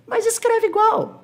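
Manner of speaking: very high, loud falsetto objection; slow, mock-indignant squeal; protesting student. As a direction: whine back in a comic squeaky student voice. Voices a protesting student, teacher answers.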